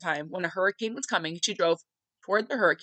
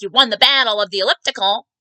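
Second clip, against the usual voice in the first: loud, emphatic retold concession; punchy, aggrieved declaration, acted; mock outburst; 2 semitones higher, 10 dB louder than usual. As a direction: imitate dad loudly conceding defeat. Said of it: "And he's like".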